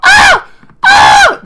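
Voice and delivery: high, loud mocking screams; girl's scream, imitated. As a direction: shriek like the girl behind him. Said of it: "The bitch started".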